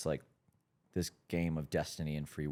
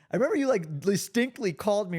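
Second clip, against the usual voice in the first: much higher, louder excited telling; 14 semitones higher, 7 dB louder, faster than usual. Real, not acted. Guest excitedly recalling being called out, high and loud. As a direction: bubble over as you remember it.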